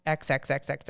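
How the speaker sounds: usual speaking voice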